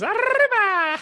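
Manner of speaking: high, loud festive shout; excited cheer. Pretend. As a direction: yell it like a party cry. Cinco de Mayo cheer.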